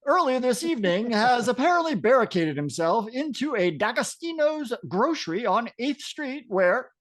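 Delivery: emphatic newsroom report; TV announcer voice. Acted. Announcer reporting a barricade at the grocery, announcer voice. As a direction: deliver it as an on-air news bulletin. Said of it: "do the announcer voice coming from the T V".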